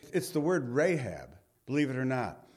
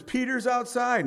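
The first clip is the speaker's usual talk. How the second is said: excited announcement; 10 semitones higher, 4 dB louder than usual. Retold or imitated. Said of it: "the servant girl ran and said".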